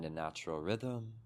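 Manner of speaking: calm meditation guide, long pauses between phrases; unhurried, settling delivery, leaving space after each instruction; guided meditation. Real, not acted. Teacher guiding listeners to soften body and mind, guided meditation.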